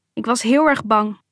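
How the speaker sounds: plain reading voice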